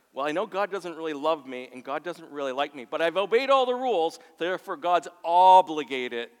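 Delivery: loud, high-pitched mock argument; hammed-up indignant self-justification; insistent protest. Mimics a rule-keeper's loud insistent argument.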